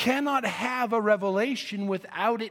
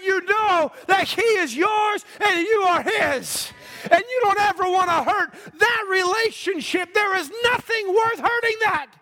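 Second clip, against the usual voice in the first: rising from lively to loud, high preaching; building sermon shout; 8 semitones higher, 3 dB louder than usual. Real, not acted. Preacher rousing the congregation, climbing to a shout. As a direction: build it up until you're shouting.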